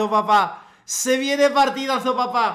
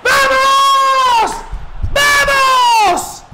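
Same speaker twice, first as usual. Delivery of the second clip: high, loud victory scream; real gamer celebration yell; ecstatic shout; 11 semitones higher, 5 dB louder, much slower than usual. Real, not acted. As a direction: roar it like a last-minute winner. Big goal celebration.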